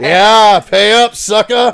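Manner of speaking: teasing. Real, not acted. Teasing friend to tell story.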